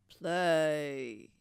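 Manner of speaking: low booming command; giant roar. Acted. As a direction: roar the order at the harp. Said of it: "he roared".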